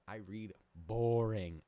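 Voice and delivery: slow, heavy drawn-out boring; deadpan verdict. Pretend. Comic telling the crowd flannel is dull, slow and loud. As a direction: drag the word out like it bores you.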